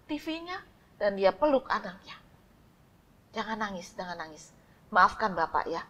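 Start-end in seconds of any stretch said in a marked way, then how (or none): 3.4-5.8 tender comfort. Acted. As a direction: hush the child gently. Father hugging crying child.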